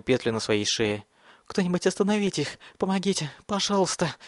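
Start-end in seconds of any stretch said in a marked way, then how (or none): 1.5-4.1 high, frantic pleading cry; desperate begging. A narrator's reading. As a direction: beg frantically for help, high. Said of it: "Он продолжал умолять".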